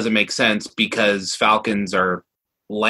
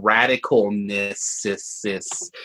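drawn out; 3 semitones lower, 3 dB quieter, much slower than usual. Retold or imitated. Listing the judging criteria.

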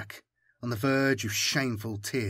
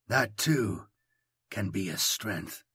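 soft, slow and chilling; 4 semitones lower, 4 dB quieter, slower than usual. A narrator's reading. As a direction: let the menace sit in the quiet. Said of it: "He smiles, and it is chilling".

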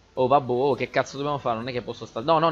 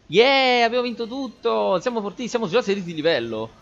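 high, loud victory cheer; esultanza; 9 semitones higher, 4 dB louder than usual. Real, not acted. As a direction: celebrate at the top of your pitch, then race on. Celebrates winning. (esultanza trionfante, acuta)

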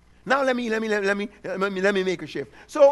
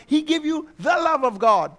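ordinary talk, nothing marked

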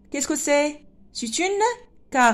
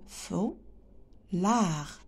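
"Feulard" in two softer beats, fou, long pause, lard; split into syllables; 4 semitones lower, 8 dB quieter than usual. Shown as a demonstration. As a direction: break "Feulard" slowly after the first syllable. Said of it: "On a deux syllabes". (« Feulard » découpé, fou… lard)

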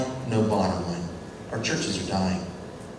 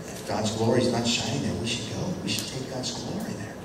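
hushed earnest; 4 semitones lower, 8 dB quieter than usual. Retold or imitated. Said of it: "A dog says".

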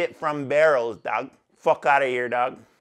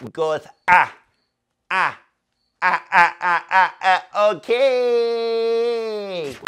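loud ah ad-libs; 2 semitones higher, 5 dB louder, slower than usual. Acted. Vocal ad-lib sounds.

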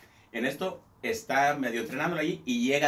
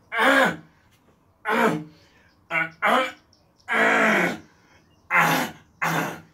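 much higher, louder wordless grunts; loud mute grunting; 8 semitones higher, 7 dB louder, much slower than usual. Retold or imitated. Mute customer loudly grunting a song at the bartender. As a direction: belt out grunts like a mute singing.

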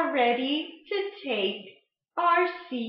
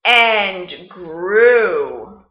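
story reading; 7 dB louder than usual. Acted. Teacher reading Where the Wild Things Are to the class, storytime voice. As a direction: read it like storytime, letting big moments swell. Teacher reads picture book aloud.

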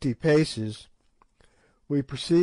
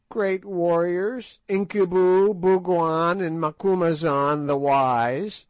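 piping witch; 5 semitones higher than usual. A narrator's reading. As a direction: pipe it out like an ancient sly witch. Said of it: "she piped".

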